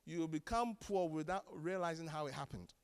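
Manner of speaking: usual speaking voice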